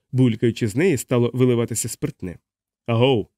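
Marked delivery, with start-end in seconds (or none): none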